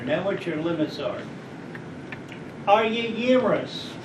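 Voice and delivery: ordinary talk, nothing marked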